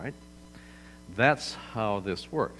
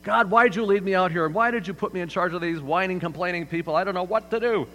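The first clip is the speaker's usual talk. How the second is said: higher, louder worried complaint to God; anxious Moses pleading; 8 semitones higher, 6 dB louder than usual. Retold or imitated. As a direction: whine to God like a cornered leader. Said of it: "He goes".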